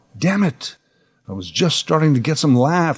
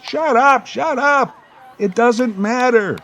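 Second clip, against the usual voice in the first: much higher, frantic shut up; exasperated shout; 11 semitones higher than usual. Retold or imitated. Kid yelling at nitpicking friends, frantic and high. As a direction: shut them up in frustration.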